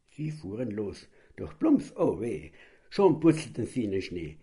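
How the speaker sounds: ordinary delivery, nothing marked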